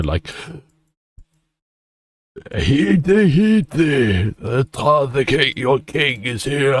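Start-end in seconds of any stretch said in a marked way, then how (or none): 2.6-6.7 town crier. Pretend. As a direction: announce the king's arrival to the crowd. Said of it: "a town crier that goes before me".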